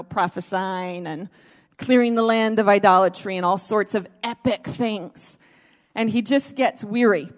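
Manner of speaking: ordinary delivery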